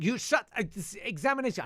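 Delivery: high, loud mock splutter; spluttering teacher. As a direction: imitate a teacher too cross to finish.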